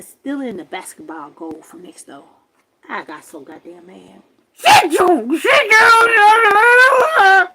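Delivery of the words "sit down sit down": high, very loud shout; real yelled command. Real, not acted. Interrupting the story to order someone to sit, yelled command. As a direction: belt it out, drawing out the last one.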